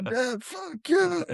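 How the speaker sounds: "Dad? Fuck you." shot up into a falsetto squeal; very high mock squeak. Bender parodying his father in a squealing falsetto. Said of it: "He's like".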